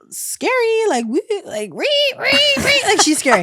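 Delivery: high squeaky stammer on scary; mock squeak of fear. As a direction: squeak it out like a nervous kid.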